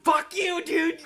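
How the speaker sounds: loud, high-pitched comic outrage; high, loud indignant burst; angry shout. Real, not acted. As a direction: yell it at your friend. Heated argument with co-host.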